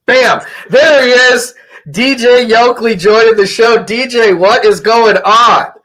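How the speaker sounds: high, hyped-up radio host greeting; big on-air hello, last words stretched out; excited welcome. Real, not acted. Host welcomes late guest onto show.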